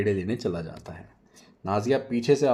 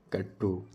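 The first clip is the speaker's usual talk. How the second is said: whispered katto; 3 semitones lower, 7 dB quieter, slower than usual. Acted. Whispered sound effect.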